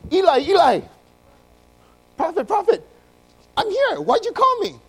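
put-on high, urgent boy's call; high-pitched, breathless-eager young voice; young Samuel calling out. Acts boy Samuel waking Eli.

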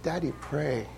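put-on very soft, gentle child's reminder; imitated son, hushed. Son telling his angry daddy to pray, hushed imitation. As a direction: quietly mimic the boy's small plea. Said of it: "He said".

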